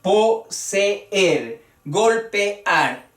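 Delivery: "poseer, golpear" split po-se-er, gol-pe-ar; proper hiatus. Shown as a demonstration. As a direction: separate beats for both vowels in "poseer" and "golpear". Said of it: "así como debía pronunciarse el hiato".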